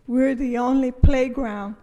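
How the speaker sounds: ordinary talk, nothing marked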